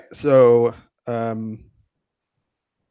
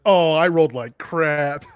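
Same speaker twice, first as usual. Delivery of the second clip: slow, higher mock quote through laughter; mimicking the game master; 4 semitones higher than usual. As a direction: echo the game master, amused and drawn out. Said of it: "When the G M says".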